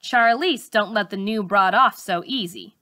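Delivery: ordinary delivery, nothing marked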